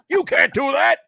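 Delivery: high, loud mock outrage; retold scolding. Bosses objecting to the prize giveaway, retold scolding.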